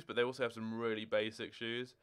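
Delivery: normal talking voice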